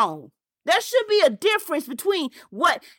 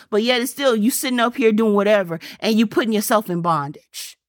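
normal talking voice

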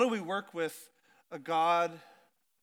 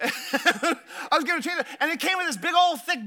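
laughing through "I was given a chainsaw", higher and louder; amused repeat of "I was given a chainsaw"; chuckling at the gift; 7 semitones higher, 5 dB louder than usual. Real, not acted.

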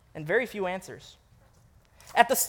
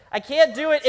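higher, louder, ending on a rise; exasperated repeat, pitched up; emphatic refusal; 6 semitones higher, 6 dB louder than usual. Shown as a demonstration. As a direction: insist you truly can't.

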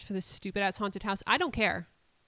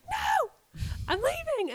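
much higher, frantic squeaky refusal; squealed mock panic; 3 dB louder, much slower than usual. Grace refusing the horseman, high panicked squeak. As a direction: squeak it out like a scared kid.

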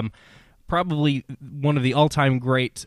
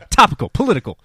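hammy tagline; 9 semitones higher, 10 dB louder, faster than usual. Acted. Jokey tagline after rimshot.

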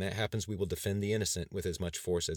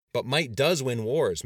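slow, emphatic, louder retort; higher, forceful rebuttal; raised voice; 5 semitones higher, 8 dB louder, slower than usual. A narrator's reading. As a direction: counter loudly, leaning on each word. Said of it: "Mister Markison raised his voice".

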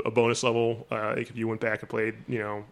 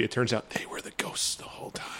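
whisper; 8 dB quieter than usual. Acted. Spoiler reveal said hushed.

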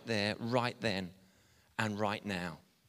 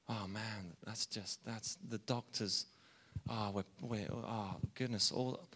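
much softer, lower, halting; subdued dismay; 4 semitones lower, 9 dB quieter than usual. Real, not acted. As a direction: falter under the weight of it, almost to yourself. Moved while speaking of cancer.

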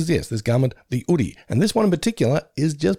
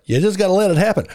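mock advice; 8 semitones higher, 4 dB louder than usual. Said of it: "as I said to my last girlfriend".

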